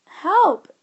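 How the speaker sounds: high, loud robotic yelp; mechanical cry for help. A narrator's reading. The computer in the backpack crying out, mechanical and high. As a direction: cry out like an alarmed machine. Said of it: "came the mechanical voice".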